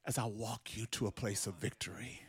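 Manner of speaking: low, soft, intimate promise; soft tender Jesus. Acted. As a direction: draw them close, almost hushed. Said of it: "and he says".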